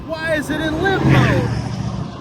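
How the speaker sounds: high, slow voice of a curious viewer; mock viewer question. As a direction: ask it as the puzzled viewer would. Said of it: "So you might ask".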